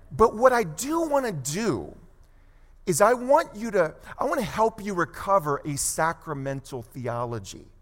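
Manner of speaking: usual speaking voice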